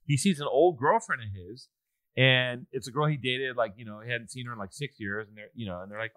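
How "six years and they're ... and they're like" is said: ordinary delivery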